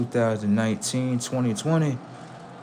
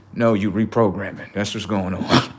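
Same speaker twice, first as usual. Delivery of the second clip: ordinary talk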